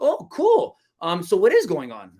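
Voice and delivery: squeaky, impressed "Oh, cool", then the question; high-pitched sound. Shown as a demonstration. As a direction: jump up high like an easily impressed listener. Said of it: "I do it with a high pitched sound".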